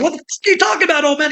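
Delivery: high, fast snarling robber voice; mock robber. Robber to old clerk, high and fast mock snarl. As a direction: sneer it like a rattled robber.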